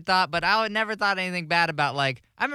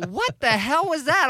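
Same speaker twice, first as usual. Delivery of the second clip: high-pitched mock disbelief; taken-aback girlfriend; 9 semitones higher, 3 dB louder than usual. Girlfriend questioning his accent, mock taken-aback. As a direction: imitate a girlfriend, higher and incredulous. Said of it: "my girlfriend's like".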